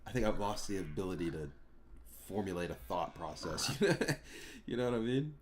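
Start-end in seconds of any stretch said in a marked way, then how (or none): 3.8-4.6 short laugh. Real, not acted. Laughs at own scatterbrain.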